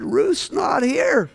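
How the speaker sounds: high, slow, pleading cry of confusion; retold high, drawn-out plea to the hurt leaders; bewildered protest. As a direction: protest that you don't know what went wrong. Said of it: "I said".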